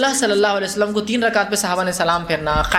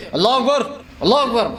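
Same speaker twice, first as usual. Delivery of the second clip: commanding call; 3 semitones higher than usual. Shown as a demonstration. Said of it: "حکم دیتے".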